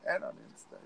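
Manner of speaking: near whisper. Real, not acted. Trailing aside.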